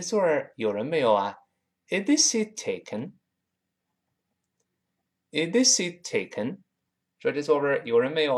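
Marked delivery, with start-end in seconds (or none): 1.9-3.1 "Is this seat taken" with "this" high and rising; a clear teacher's model question, "this" lifted high. Shown as a demonstration. Teacher models the English question for students, bright rising tone. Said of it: "我们把这句话再来一遍". 5.3-6.6 "Is this seat taken" with "this" high and rising; a clear teacher's model question, "this" lifted high. Shown as a demonstration. Teacher models the English question for students, bright rising tone. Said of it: "再来一遍".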